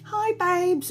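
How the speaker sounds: butter up the daughter, sugary and quiet; sweet-talking. Retold or imitated.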